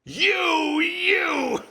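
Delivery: high, loud hammed-up phone shout; mock Ollie shout. Prank calling a friend, mock-furious shout. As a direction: bellow it down the phone as a joke. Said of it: "I just wanted to say".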